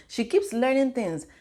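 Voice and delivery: ordinary talk, nothing marked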